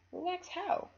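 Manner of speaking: puzzled question. Acted. Mom asking, puzzled.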